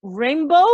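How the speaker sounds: "rainbow" loud, with untrilled opening R; "rainbow" as an English model word, tongue back for R; English throat R. Shown as a demonstration. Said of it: "así digo".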